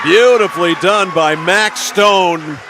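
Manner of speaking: loud, high, drawn-out praise; thrilled sportscaster, stretching the words; excited play call. Real, not acted. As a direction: savour the great play at full voice. Live call of a scoring play.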